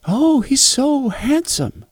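put-on high, slow little girl swooning; a small girl cooing over the lion. Daughter voiced admiring lion.

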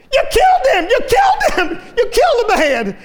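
high frantic scream; shrieking woman. Retold or imitated. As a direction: scream it in panic. Woman screaming in retold story.

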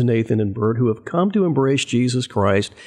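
usual speaking voice

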